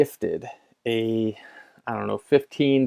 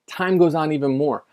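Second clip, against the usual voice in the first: ordinary talk, nothing marked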